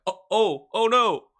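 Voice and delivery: high, loud mock panic "oh no". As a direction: play it like sudden comic dread. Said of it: "you're like".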